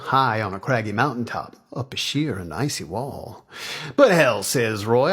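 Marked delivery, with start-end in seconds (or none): 4.0-4.4 loud, higher defiant outburst; defiant vow. Acted. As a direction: dig in, loudly. Roy defiantly vows to climb.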